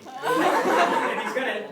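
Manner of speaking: ordinary delivery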